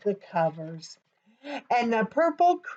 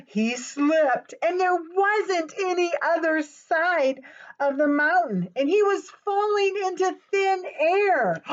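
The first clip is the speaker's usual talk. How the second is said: dramatic; 8 semitones higher than usual. A narrator's reading. Dramatic falling moment.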